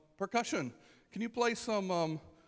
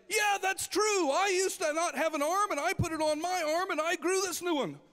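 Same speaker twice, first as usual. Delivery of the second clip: high shouted fake testimonial; paid shill in the crowd; 12 semitones higher, 5 dB louder than usual. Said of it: "somebody in the back will say".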